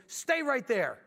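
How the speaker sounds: high, loud imitated order; furious retold command. Furious client leaving the young contractor waiting, imitated sharp command. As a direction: order him to stay put, sharply. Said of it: "The guy was furious".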